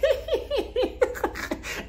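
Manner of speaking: high mock giggling fit; high, helpless cackle, put on; imitated laughter. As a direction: imitate a tipsy friend's high-pitched laugh. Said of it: "she's laughing".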